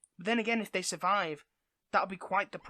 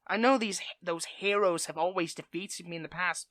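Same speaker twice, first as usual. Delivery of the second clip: normal talking voice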